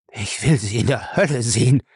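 growled defiance in a character voice; resentful growl. A narrator's reading. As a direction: growl it at your attacker. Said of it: "grollte Lacey".

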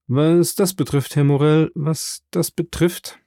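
hedging, second phrase drawn out slowly; hesitant reply. A narrator's reading. Sailor reports back to his shipowner, hesitant and halting. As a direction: stall for words, slowing as you repeat yourself.

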